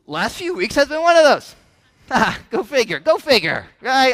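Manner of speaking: loud, high, laughing ruefully; loud rueful laughter. Real, not acted. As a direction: laugh it off, exasperated. Pastor admits hard season, laughing.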